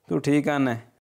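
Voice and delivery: ordinary delivery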